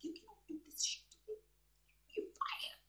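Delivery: very soft, breath-heavy dismissal; hushed mock firing. Boss firing the woman who challenged him, hushed mock outrage. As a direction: fire them in a near whisper.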